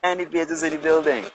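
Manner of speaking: lightly performed party DJ call. Acted. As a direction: repeat the DJ's hype line, lighter. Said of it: "D J goes".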